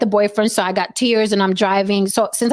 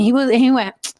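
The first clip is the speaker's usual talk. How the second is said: normal talking voice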